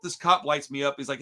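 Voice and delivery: usual speaking voice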